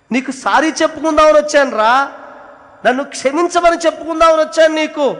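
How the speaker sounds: high, loud, desperate plea; mother's letter begging her son's forgiveness; anguished pleading. Acted. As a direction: cry out your apology to your son.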